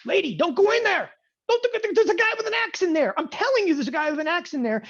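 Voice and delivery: high, loud mock yell at a horror film heroine; yelling at the screen. Viewer yelling at a movie character, loud mock panic. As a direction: shout a warning at the screen, half-joking. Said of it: "literally screaming at the screen watching this movie".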